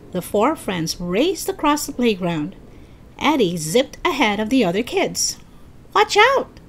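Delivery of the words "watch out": very high, louder cry of alarm; screamed warning. A narrator's reading. Melody warns Eddie of the bus, screaming. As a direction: shriek a quick warning, narrator-style. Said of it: "Melody screamed".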